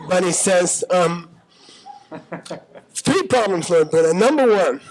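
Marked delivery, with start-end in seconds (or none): 2.9-4.8 mildly irritated objection, pitched up; a little irritated. Acted. Subhuti listing his problems to Lord Buddha, a little irritated. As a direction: act slightly fed up with the teacher. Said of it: "Subhuti gets a little irritated".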